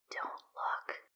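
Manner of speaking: slow, soft eerie whisper from far off; ghostly whisper. Acted. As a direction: breathe it like an unseen thing. Unseen voice whispers command.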